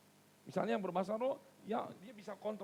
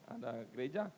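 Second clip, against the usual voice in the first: usual speaking voice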